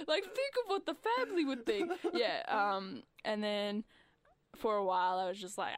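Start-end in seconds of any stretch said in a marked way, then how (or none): 0.1-1.9 mock scolding mum. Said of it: "mum shaming job choice".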